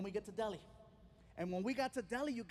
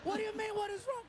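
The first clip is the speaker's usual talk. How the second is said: high, loud incredulous yell; outraged disbelief; 11 semitones higher, 4 dB louder, faster than usual. Retold or imitated. Passenger shouting back at the clueless driver, incredulous. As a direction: throw his question back at him, shrill with disbelief.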